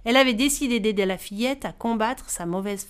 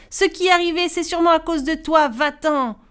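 higher, louder angry scolding; angry dismissal; 6 semitones higher, 4 dB louder than usual. Acted. Farmers chasing the cursed girl away, angry and loud. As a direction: drive her out of the farm.

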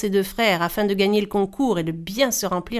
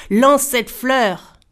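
slow, louder, hard command; harsh cry; 3 semitones higher, 6 dB louder, much slower than usual. Acted. Greedy brother ordering his sister, harsh. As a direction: bark the order, patience gone. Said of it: "La voix de son frère s'était faite plus dure".

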